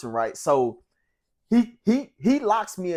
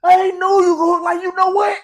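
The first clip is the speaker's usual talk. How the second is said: high, loud impressed inmate voice; imitating Amp; 10 dB louder than usual. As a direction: do the older roommate, impressed and loud. Amp praising him after fight.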